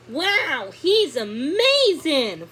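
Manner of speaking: put-on awed excited girl; amazed. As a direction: marvel at the bee. Seeing the bumblebee.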